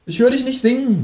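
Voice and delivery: very high, expectant creature voice; Känguru. A narrator's reading. Kangaroo waits for companion to sing, high expectant voice. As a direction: prompt expectantly, pitched right up. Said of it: "sagt das Känguru".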